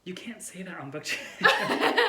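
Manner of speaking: slow, laughing stage whisper; mock-scandalized whisper. Real, not acted. Friend gasps at a BookTube taboo, laughing whisper. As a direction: hiss it like a forbidden secret, half laughing.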